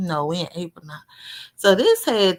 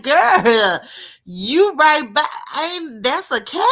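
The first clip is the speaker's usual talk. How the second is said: put-on louder and higher, a startled slow "girl" into a disbelieving question; shocked exclamation; 5 semitones higher, 6 dB louder, slower than usual. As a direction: cry out in alarm at the strange cat. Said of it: "I'm like".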